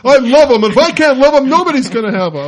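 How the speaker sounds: jealous rival's melodramatic outburst; mock jealous lover. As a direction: overplay a clingy lover who won't let go. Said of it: "it was one of those".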